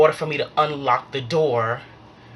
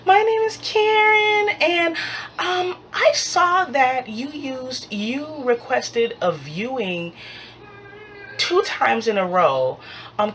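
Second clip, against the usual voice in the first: Karen; 9 semitones higher than usual. Retold or imitated. Invitation Homes lady querying the viewings, high put-on voice. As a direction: imitate a high, polite office caller.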